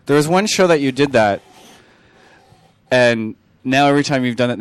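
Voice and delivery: normal talking voice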